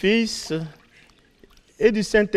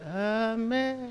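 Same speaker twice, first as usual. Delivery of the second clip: ordinary talk, nothing marked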